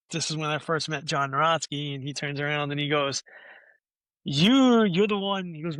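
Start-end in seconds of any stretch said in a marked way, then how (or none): 4.2-5.5 loud, high, drawn-out "you"; single someone out loudly; acted-out booming recognition; accusing call-out. Manager singles him out on meeting.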